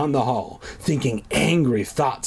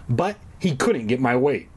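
usual speaking voice

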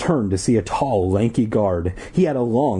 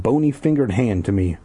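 usual speaking voice

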